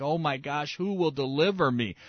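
hammed-up cry for help; mock desperate cry. Preacher imitating sinners crying out, mock desperate. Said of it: "screaming out in their sin like".

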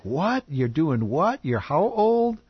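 high, incredulous questions rising at the end; astonished reaction. Retold or imitated. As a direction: re-enact being amazed by each new detail. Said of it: "I was just so intrigued".

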